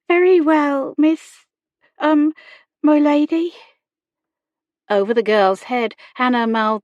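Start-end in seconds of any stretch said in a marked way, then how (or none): 0.1-3.5 put-on high, loud girlish voice; young girl correcting herself, pitched up; deferential servant girl. A narrator's reading. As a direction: answer high and halting, as a young maid. Servant girl answering her lady.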